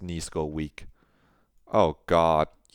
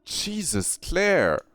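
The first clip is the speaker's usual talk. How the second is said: higher, gasped dismay; a sharp shocked breath, higher; 11 semitones higher, much slower than usual. Acted. Jamie seeing the bathroom, shocked and higher.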